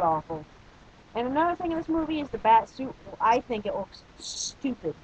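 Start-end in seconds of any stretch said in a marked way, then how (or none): none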